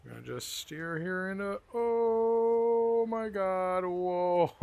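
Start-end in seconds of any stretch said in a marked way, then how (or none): 1.7-4.6 surprised. Real, not acted. Car flies through tunnel.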